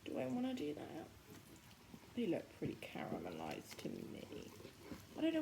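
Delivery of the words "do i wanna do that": ordinary delivery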